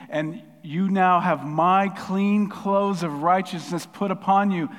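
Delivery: loud, slow, high declaration; impassioned preaching. Acted. Jesus as advocate claiming the believer, impassioned proclamation. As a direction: proclaim it emphatically, stretching the key words.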